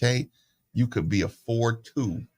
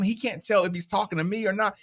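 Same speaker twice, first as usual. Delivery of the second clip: usual speaking voice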